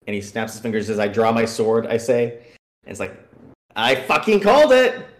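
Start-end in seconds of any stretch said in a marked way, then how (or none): 3.8-5.0 loud, high i-told-you-so; triumphant shout. Retold or imitated. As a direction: yell it, vindicated. Player proven right about trap.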